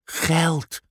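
put-on high, dreamy child voice; a girl picturing treasure; dreamy little girl. (hoog, dromerig meisjesstemmetje)

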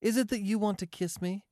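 high, teasing woman's question; amused. A narrator's reading. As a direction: ask it high, with a touch of amusement. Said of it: "cried Marcia, laughing".

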